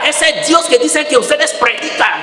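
high, angry accusing cry; grieving man yelling. Retold or imitated. Grieving father yelling at the monk about his son, high and angry. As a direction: rail at God in grief. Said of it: "empezó ya a gritar".

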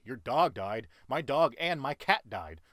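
loud, slow indignant one-up; mock one-upping. One-upper outdoing a grieving friend, loud and mocking. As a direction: scoff and top the other's loss.

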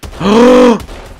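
sudden high, loud shocked gasp; sharp gasp. Real, not acted. Gamer sees a triple lineup, sharp shocked gasp. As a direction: gasp in disbelief.

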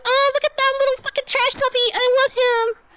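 very high, cutesy squeal; gushing squeal. Retold or imitated. Streamer retelling seeing raccoons in the park, high gushing squeal. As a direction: imitate yourself squealing over a baby animal.

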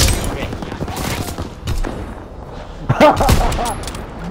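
high burst of real laughter; long, wheezy high laugh; laughing hard. Real, not acted. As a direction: crack up at the mishap. Cracks up after teammate's mishap.

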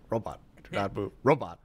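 flat robotic command voice. Shown as a demonstration. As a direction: say it stiffly like a robot. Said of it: "they gotta talk like a robot".

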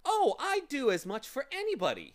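high, loud character voice; gloating storybook villain; boastful villain. A narrator's reading. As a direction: toss it off loudly and high. Villain's reply acted by narrator.

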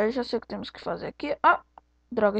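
ordinary delivery

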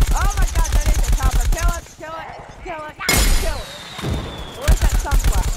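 loud, frantic gamer yell; excited yelling. Real, not acted. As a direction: scream at your teammate to kill it now. Chopper spotted mid-game.

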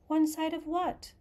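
lightly voiced Alice, wondering to herself. A narrator's reading. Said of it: "thought Alice to herself".

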